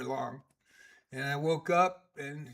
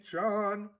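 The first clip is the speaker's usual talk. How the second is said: cat calling John; 6 semitones higher than usual. Retold or imitated. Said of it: "he would call me John".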